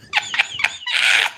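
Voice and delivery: tiny squeaky whistle note; whistle-tone squeal. Shown as a demonstration. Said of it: "[name] in her whistle tones".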